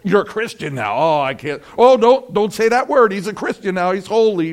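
mocking. Scoffers ridiculing a believer, sarcastic and loud. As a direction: jeer at the holy one. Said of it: "When they're going".

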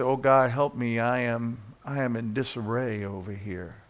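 lightly acted low, soft prayer; mock weary plea. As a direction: softly play a bogged-down believer pleading. Acting out a bogged-down believer's prayer.

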